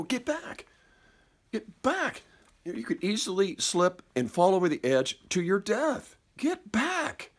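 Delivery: high, panicked warning to get back; the frightened brain yelling retreat at the cliff edge; fearful inner voice. Acted. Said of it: "a voice immediately that responded and reacted from that emotional part of the brain".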